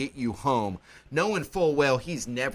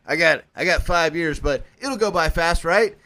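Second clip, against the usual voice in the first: hopeful bravado; 5 dB louder than usual. Retold or imitated. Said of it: "I was like".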